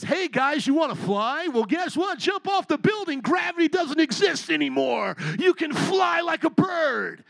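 mock preacher rising to a shout; false preacher. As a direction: hype them up to jump like a reckless showman. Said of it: "How about if I say this".

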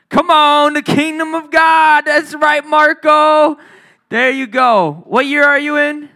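high, loud, drawn-out celebration; excited cheer. Real, not acted. Preacher hailing Marco's right answer, excited. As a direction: call out the win, loud and high.

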